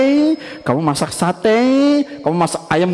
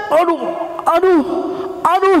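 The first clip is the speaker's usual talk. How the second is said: high, rising little cries of strain; pained ouches; 9 semitones higher than usual. Acted. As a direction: ouch on every pull. Struggling up the tree. (mengaduh sambil memanjat)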